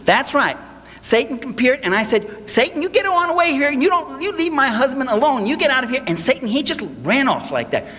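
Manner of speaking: hammed-up pious wife shooing away the devil; mocking the preacher's wife. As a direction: parody of a TV evangelist's wife bragging she chased off Satan. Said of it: "Then his wife chimes in".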